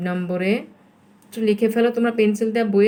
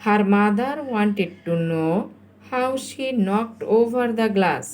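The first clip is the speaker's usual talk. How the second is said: slow dictation; much slower than usual. Shown as a demonstration. Teacher gives pupils the completed answer, very slow dictation. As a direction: draw out each word.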